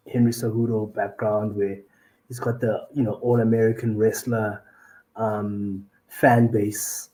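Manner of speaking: ordinary delivery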